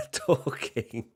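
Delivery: normal talking voice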